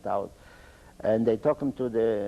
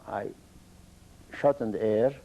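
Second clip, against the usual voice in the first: ordinary talk, nothing marked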